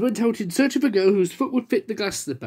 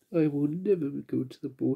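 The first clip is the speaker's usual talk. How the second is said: soft, low tearful line; sobbing; 4 semitones lower, 7 dB quieter than usual. Acted. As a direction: say it through tears by the fire. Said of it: "she sobbed".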